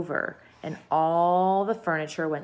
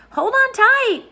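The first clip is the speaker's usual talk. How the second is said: excited warning; 9 dB louder, slower than usual. A narrator's reading. Grasshopper warns as peach heaves.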